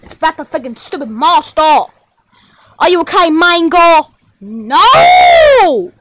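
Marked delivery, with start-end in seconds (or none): 4.4-5.9 long swooping high wail; drawn-out, hammed-up cry of no; wailed refusal. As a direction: swell the word up high and let it fall. Acted outcry after being asked.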